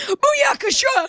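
very high, squeaky goofy call; squeaky high catchphrase. Retold or imitated. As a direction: squeal the word Booyakasha. Repeated much higher.

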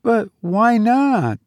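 put-on slow, high, puzzled girl; wondering. A narrator's reading. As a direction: ask slowly in wonder, pitch well up. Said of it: "said Olive wonderingly".

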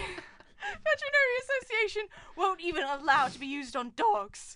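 high-pitched put-on character voice; cartoon character. Prisoner protesting lethal drug, high cartoon voice. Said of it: "that guy from Flushed Away".

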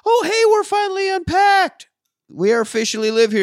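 very high, put-on cheery wife voice; hammed-up high-pitched perky announcement; trying to be chipper. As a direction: imitate a wife forcing bright cheer. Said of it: "she's trying to be all chipper".

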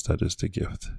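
soft breathy whisper of the repeated line. Shown as a demonstration. Podcast host repeats his point to listeners, whispered. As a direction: drop to a hushed whisper.

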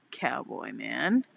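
low, slow flirty drawl; mock flirtation. Retold flirting with cowboy.

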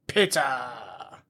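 soft, low, falling "Pizza"; quiet, sighed punchline after a big build-up; hushed reveal. Pretend. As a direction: drop to a reverent, drawn-out hush.

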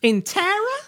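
put-on high Southern belle accent; Southern lady's lilting question; Scarlett O'Hara, Southern. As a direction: ask it as a mock Southern belle. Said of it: "borders on Scarlett O'Hara, uh, of Southernness".

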